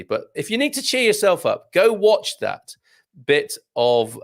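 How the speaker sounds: normal talking voice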